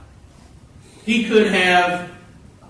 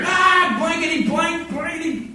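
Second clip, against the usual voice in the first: mock swearing rookie; 12 semitones higher than usual. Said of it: "starts swearing".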